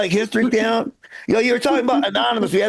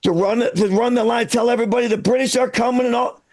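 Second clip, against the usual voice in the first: put-on a light Boston townie accent running a rowdy meeting; gruff Boston regular calling the meeting to order, only a hint of accent. Boston townie chairing the Sons of Liberty meeting, light Boston accent. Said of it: "people in Boston spoke with the same accent they have now".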